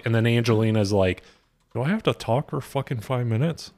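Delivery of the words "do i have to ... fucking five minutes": lightly voiced drunk Angelina, softer; a hint of a sloppy, drunk protest; Angelina impression. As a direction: mumble it like a put-upon drunk girl. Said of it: "That was pretty good".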